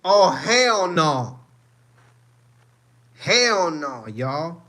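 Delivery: loud, high, drawn-out refusal; high-pitched shout of indignant disbelief; outraged outburst. Real, not acted. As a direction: holler it in real outrage, stretching the words. Outburst at the clip just watched.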